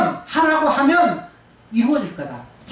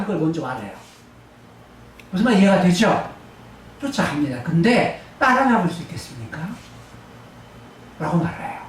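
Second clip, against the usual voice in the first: ordinary delivery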